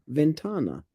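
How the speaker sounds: ventana stressed on TA; penultimate stress. Shown as a demonstration. Giving partner the example, ventana stressed on TA. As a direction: lean on the middle of ventana, ven-TA-na. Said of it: "the stress typically falls on the penultimate".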